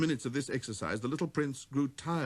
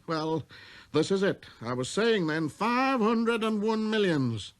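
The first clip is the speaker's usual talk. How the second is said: self-important, slightly higher voice; pompous businessman; 6 semitones higher than usual. Acted. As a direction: lecture with busy importance. Businessman explains.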